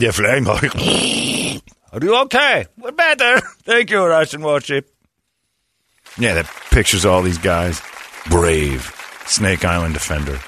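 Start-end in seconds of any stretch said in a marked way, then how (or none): 1.9-4.9 high mock-grateful voice; mock grateful islanders. Islanders thanking the warship, high mock gratitude. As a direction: gush thanks to your rescuer. Acted rescue dialogue.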